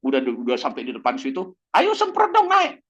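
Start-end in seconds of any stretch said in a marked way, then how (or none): 1.8-2.8 much higher, louder call to spray; shouted order. Acted. Fire chief yelling orders at firefighter, loud call. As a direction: yell up at the crew to get going.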